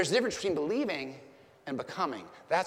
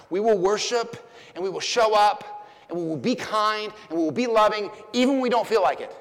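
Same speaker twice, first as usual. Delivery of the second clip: raised, louder pulpit declaration; preaching at full force; 6 semitones higher, 7 dB louder than usual. Real, not acted.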